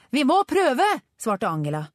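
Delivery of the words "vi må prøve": high, loud, urgent reply; determined shout. A narrator's reading. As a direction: call back with resolve. Shouted answer during scooter chase.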